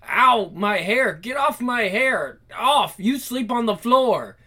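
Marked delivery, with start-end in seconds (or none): none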